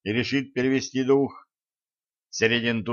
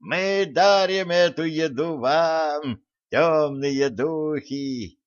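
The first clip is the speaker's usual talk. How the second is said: slow, higher triumphant offering; triumphant shaman; 5 semitones higher, slower than usual. Acted. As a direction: offer the feast to the spirits. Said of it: "торжествующе плясал шаман".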